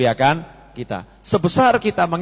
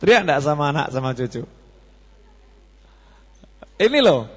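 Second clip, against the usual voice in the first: ordinary delivery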